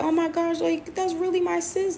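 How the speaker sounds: high-pitched gushing over a friend. Retold or imitated. Recalling how she saw her friend as a sister, gushing. Voicing her past admiring self.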